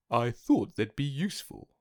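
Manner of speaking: lightly voiced different-sounding Jaguar. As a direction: offer the gift modestly. Jaguar giving gift.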